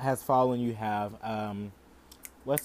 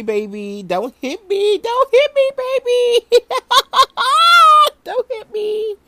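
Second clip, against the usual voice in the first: much higher, louder falsetto begging; mock pleading squeal; 12 dB louder, slower than usual. Host mock begs Will Smith not to hit her, falsetto. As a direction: squeal like a scared damsel. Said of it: "Will Smith, don't hit me".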